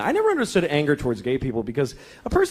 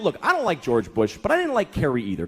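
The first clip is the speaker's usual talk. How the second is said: ordinary delivery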